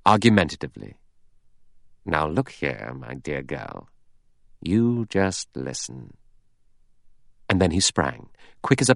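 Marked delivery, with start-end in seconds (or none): 2.1-6.2 soft, low, deliberate reasoning; measured, low-voiced persuasion; slow, argumentative. A narrator's reading. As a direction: argue it slowly and quietly, drawing out the last words. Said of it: "He spoke slowly, argumentatively".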